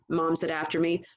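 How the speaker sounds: plain reading voice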